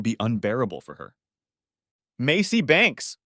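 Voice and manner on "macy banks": loud call across a waiting room; shouted name. A narrator's reading. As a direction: call out the name, raised and high. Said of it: "the receptionist shouted".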